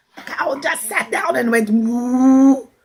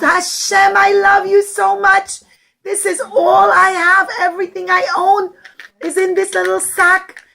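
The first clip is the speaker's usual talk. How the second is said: heartfelt prayer; 6 semitones higher, 4 dB louder than usual. Acted. Poor man's heartfelt prayer.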